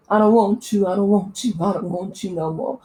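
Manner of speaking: lightly imitated movie mother's line; Angela Bassett as Katherine Jackson. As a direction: do a hint of a dramatic actress impression. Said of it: "she does her imitation of her".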